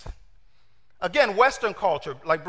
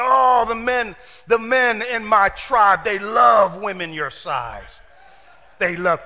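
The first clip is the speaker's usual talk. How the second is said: louder, slow, dead-serious pitch for the tribe's men; slow, emphatic courting voice, lightly played; earnest African suitor; 5 dB louder, slower than usual. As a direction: sell it like a proud matchmaker. Voicing African colleague, dead serious.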